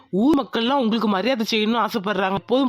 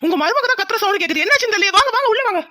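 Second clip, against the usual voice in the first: urgent alarm; 7 semitones higher, 3 dB louder, faster than usual. Acted. Inspector hears scream, urges in.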